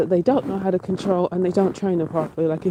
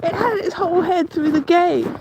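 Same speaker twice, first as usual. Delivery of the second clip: shocked disbelief; 10 semitones higher than usual. Real, not acted. Still shaken after the scare.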